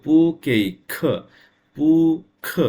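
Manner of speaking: পু খ sung out high and loud with clear tones; Chinese tones demo. Shown as a demonstration. Teacher proudly showing the art in his pronunciation, high and loud. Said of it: "দেখেন পড়ার ভিতরে আমার art আছে কিনা".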